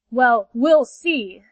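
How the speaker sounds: loud and very slow; a threatening promise, drawn out; ominous growl. Acted. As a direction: panther menacing the rays, loud and slow. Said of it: "said the panther, with another great roar".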